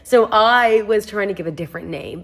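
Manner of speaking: ordinary talk, nothing marked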